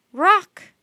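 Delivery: loud high raven croak. Acted. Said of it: "the raven croaked".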